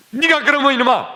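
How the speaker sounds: high, loud rebuke stressing 네가; angry scolding. Retold or imitated. Father rebukes his son before the switch, loud angry scold. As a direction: let the father's anger burst out. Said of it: "팍 야단을 치시고".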